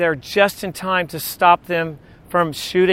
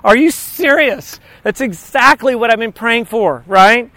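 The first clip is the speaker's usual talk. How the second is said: excited servant; 3 semitones higher than usual. Retold or imitated. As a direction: gush with excitement over the godsend. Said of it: "He's like".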